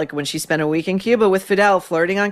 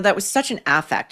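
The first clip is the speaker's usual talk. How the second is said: normal talking voice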